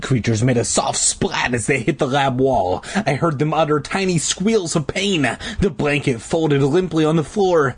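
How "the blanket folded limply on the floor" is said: ordinary delivery, nothing marked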